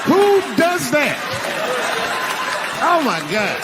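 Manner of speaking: very high, drawn-out outrage; incredulous comic whine; exasperated disbelief. Acted. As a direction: protest in a squeaky, appalled pitch. Comic outrage at wife's snooping.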